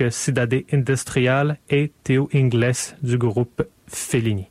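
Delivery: usual speaking voice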